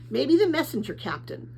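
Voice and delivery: usual speaking voice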